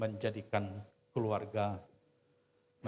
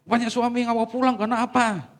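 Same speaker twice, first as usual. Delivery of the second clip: much higher and louder challenge; loud preaching question; 12 semitones higher, 12 dB louder than usual. Acted. Preacher challenging the wives in the pews, loud. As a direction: fling the question at the crowd.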